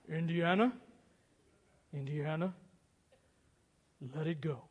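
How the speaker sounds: unhurried, steady call of a father; Sean Connery calm. Retold or imitated. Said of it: "very calmly, as only Sean Connery can".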